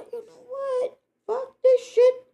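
very high, loud cry of fury; furious crying yell. Retold or imitated. Fed-up woman crying and yelling during the fight. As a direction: break into a crying, furious outburst.

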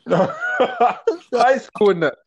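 slow laughing words. Real, not acted. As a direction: laugh your way through it. Laughing about old memory.

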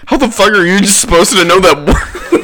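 loud, high, laughing exasperation; laughing outburst. Real, not acted. Friend reacting to the absurd phrase, real laughing outburst.

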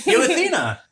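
high sing-song voice to the dog; dog-talk voice. Real, not acted. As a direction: call the dog sweetly.

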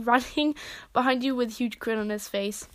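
ordinary delivery

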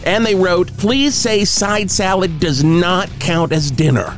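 cheesy concert calendar DJ; radio announcer. Pretend.

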